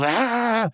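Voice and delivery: high, drawn-out mock yell; imitated wrestler yell. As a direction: cry out high like a showboating wrestler. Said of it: "he flings it back and he goes".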